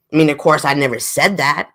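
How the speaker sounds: ordinary talk, nothing marked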